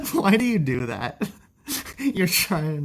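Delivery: laughing through the words; amused protest, giggling. Real, not acted. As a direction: caught laughing at a troll. Laughing at a teasing chatter.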